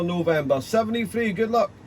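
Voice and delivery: usual speaking voice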